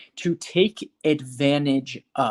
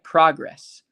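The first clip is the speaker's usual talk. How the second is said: louder PROG-ress, first syllable punched; noun stress, first syllable; 6 dB louder than usual. Shown as a demonstration. Teacher repeats the sustantivo, "progress" stressed on PROG. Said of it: "Progress is the sustantivo".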